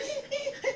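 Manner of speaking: high mocking giggle. Imitating [name] giggling on the show, high mocking giggle. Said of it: "You said".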